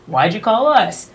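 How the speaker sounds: ordinary talk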